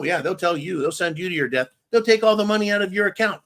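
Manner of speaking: normal talking voice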